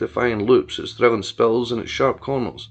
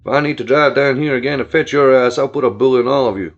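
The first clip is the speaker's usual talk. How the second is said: louder, menacing threat; 7 dB louder than usual. Acted. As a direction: lay down the law to them. Billy threatens the cousins.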